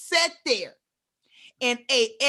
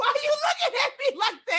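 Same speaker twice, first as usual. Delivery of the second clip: laughing shout; 12 semitones higher than usual. Real, not acted. Teasing a friend's stare, a high laughing shout. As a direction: shout it through the laugh, cracking up.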